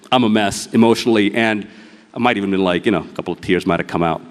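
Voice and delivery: ordinary talk, nothing marked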